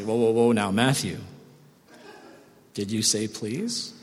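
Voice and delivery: father pretending to correct his thirsty son; stern parent. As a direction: play the prim parent asking for manners. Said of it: "I don't stop him and say".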